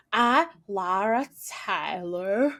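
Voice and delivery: slow, laboured attempt at an American accent; badly faked American, word by word. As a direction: struggle through a fake American accent. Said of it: "she's doing an American accent".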